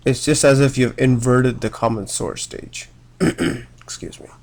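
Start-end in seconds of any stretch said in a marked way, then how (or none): none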